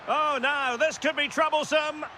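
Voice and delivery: high-pitched commentary as danger builds; excited anticipation. Real, not acted. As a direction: raise the pitch as a threat develops. Commentator warns of a dangerous attack.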